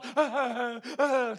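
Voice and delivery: imitated high-pitched crying; mock sobbing. Daughter breaking down before her mother, mock sobbing. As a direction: act out a wordless wail. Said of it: "他都哭了".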